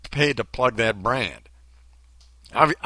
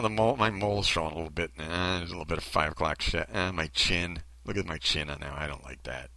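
low, sinking fussy grumble; mock self-critical; 10 semitones lower, 6 dB quieter than usual. Commentator acting out someone rejecting their selfies, low grumbling. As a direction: pick yourself apart under your breath. Acts out rejecting own photos.

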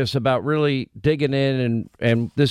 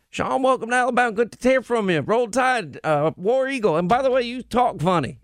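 Alabama accent imitation; 6 semitones higher than usual. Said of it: "a New Yorker that lived in Alabama".

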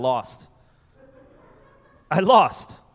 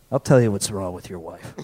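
put-on low, slurry old mayor; tipsy town official in a low voice; drunk mayor; 9 semitones lower than usual. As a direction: rumble it from the back, deep and boozy. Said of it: "he's always drunk".

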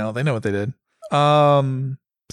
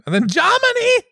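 mock German. Jokey German pronunciation.

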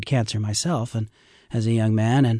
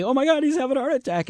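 mock alarm; 14 semitones higher, faster than usual. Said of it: "turn around thinking".

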